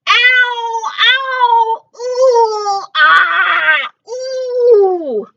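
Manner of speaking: high, loud pained howls; loud dragon wails of pain; tummy ache cries. Acted. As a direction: groan like a sick dragon. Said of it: "here comes the tummy noise".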